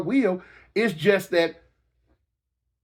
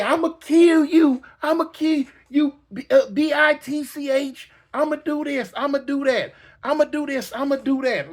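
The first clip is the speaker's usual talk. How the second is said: higher mock-angry threats; 7 semitones higher than usual. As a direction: shout threats in a fight. Fighters threatening each other.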